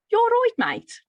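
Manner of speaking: high, loud Brummie greeting; Brummie accent. Shown as a demonstration. Teacher models a little phrase for listeners, in high, loud Brummie. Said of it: "work on your Brummie".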